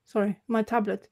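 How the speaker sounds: ordinary delivery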